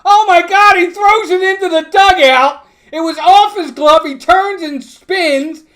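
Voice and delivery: stunned broadcaster screaming the error; shocked shout. Real, not acted. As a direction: shout as the throw sails into the dugout. Shocked call of throwing error.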